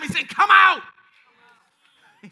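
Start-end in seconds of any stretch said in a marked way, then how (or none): 0.3-0.8 big, high, loud call; shouted summons. Acted. Preacher relaying God's call to an elder, full shout. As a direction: shout it like calling someone from a tomb.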